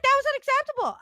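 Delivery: wife refusing her husband's calm, in a high outraged yell; mock outraged shout. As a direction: retell your fury at full pitch. Retold outrage at rude cast member.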